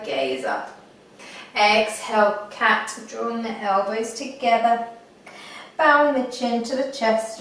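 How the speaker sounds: unhurried meditation guide, long pauses; guided meditation. Acted. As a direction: talk them gently through it, phrase by phrase.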